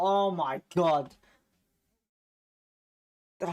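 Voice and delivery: ordinary talk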